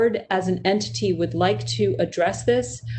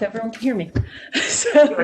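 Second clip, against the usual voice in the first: laughing; 4 semitones higher than usual. Real, not acted. Unsure she is being heard.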